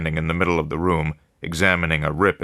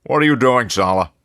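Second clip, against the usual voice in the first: loud call across the room, higher; 7 semitones higher, 5 dB louder than usual. A narrator's reading. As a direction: call out to him over the office. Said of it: "Lauderman called across the room".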